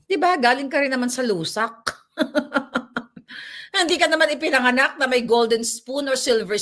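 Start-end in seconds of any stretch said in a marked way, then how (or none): 2.2-3.7 airy laugh trailing off; breathy laugh. Real, not acted. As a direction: laugh through the breath at the joke. Laughing at own joke.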